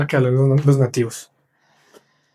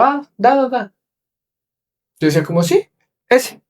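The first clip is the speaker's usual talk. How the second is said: much higher nervous yes, yes; flustered tourist; 9 semitones higher than usual. Retold or imitated. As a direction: squeak out the answers, lost and flustered. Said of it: "rapidísimo esos malparidos".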